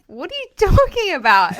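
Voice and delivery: high, loud puzzled question; confused disbelief. Retold or imitated. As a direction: sound baffled, pitch shooting up. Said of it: "I was like".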